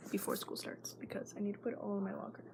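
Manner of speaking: hushed panicky whisper, not waking anyone; anxious whisper. Real, not acted. Girl whispering her panic about packing her bag. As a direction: whisper it fast under your breath.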